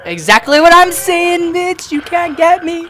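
very high gloating scream; screaming taunt. Real, not acted.

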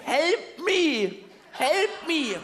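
high, drawn-out distant cry for help; mock castaway calling from far out. Castaway calling to a boat from far out at sea, high drawn-out call. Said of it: "einer gerufen".

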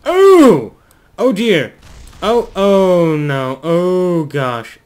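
loud yelp easing into drawn-out dismay; high, loud panicked exclamations; alarmed. Real, not acted. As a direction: cry out as the plane goes wrong. Thrust reversers cause trouble.

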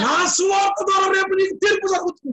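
very high, shouted pulpit warning; proclaiming scripture. Acted. Preacher warns of judgement in Paul's words, proclaiming. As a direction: sustain a high preaching cry.

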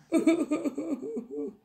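high, rapid fake sobs; boo-hoo like a little child; mock crying. Princess packing for a sleepover, mock crying. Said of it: "then began to cry".

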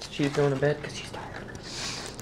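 soft unvoiced whisper. Real, not acted. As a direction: whisper so she won't wake. Whispers about sleepy person.

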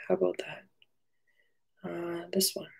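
slow hushed whisper. Real, not acted. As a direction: whisper it to yourself. Hushed aside while setting up.

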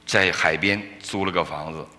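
usual speaking voice

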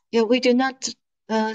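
normal talking voice

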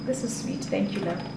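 choked-up thank you; teary thanks. Real, not acted. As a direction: hold back tears as you thank him. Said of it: "Why am I being emotional?".

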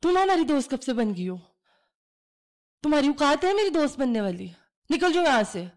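put-on girl's angry scorn.

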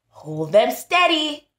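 ordinary talk, nothing marked

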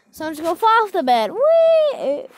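loud, quick build to a high squeal; playful whee. Pretend. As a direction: launch into a big, high "whee". Playfully falling off the bed.

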